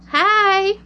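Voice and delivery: put-on a quick, friendly hello with a heavy Alabama accent; deep Alabama accent. A narrator's reading. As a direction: greet her in a broad Alabama drawl. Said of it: "she says with a deep Alabama accent".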